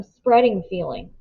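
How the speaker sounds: ordinary delivery, nothing marked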